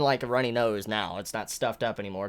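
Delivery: ordinary talk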